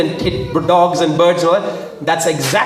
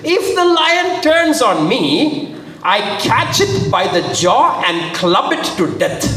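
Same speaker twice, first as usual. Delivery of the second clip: dramatic scripture reading; 7 semitones higher, slower than usual. A narrator's reading. David boasting to Saul about fighting lions, dramatic. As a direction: stretch the condition high, then land the outcome.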